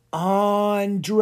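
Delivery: long, high ghostly wail; haunted voice. A narrator's reading. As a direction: call the name like a wailing ghost. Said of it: "the haunted voice said a name".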